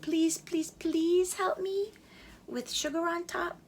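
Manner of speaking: high, slow begging; begging fire. A narrator's reading. Fire begging the chick, high and drawn out. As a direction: drag out each please. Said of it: "the fire begged and begged".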